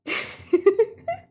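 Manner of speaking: squeaky rising laugh; high laugh. Real, not acted. Streamer laughing at the game, high giggle.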